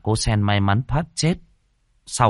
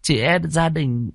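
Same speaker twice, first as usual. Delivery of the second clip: put-on old midwife explaining to the grandson; 8 semitones higher than usual. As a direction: tell the hard truth gently. Old midwife voiced again.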